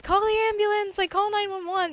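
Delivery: panicked demand. Retold or imitated. Kid with broken arm demanding her dad call nine one one, high loud plea. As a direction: beg for the ambulance, high and loud. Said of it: "I was saying, like".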